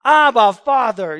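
crying out. Shown as a demonstration. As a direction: shout it out like a child calling for Dad. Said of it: "It's crying out".

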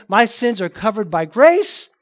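slow, loud mock declaration, rising at the end; exaggerated antinomian. Antinomian excusing sin by grace, mildly exaggerated and loud. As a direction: lightly ham up the antinomian's claim. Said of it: "they're not gonna say it like that".

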